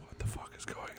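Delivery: whispered through a laugh; laughing stage whisper; mock panicked whisper. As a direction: hiss it in hushed alarm. Gohan and Supreme Kai panicking.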